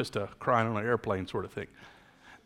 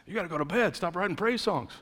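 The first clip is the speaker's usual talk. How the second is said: lightly imitated coach, higher pitch; a hint of exasperated coach, pitched up; coach reining players in; 7 semitones higher, faster than usual. Coach tells late-night songwriters to go to bed, lightly imitated. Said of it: "our coach was telling us to calm down".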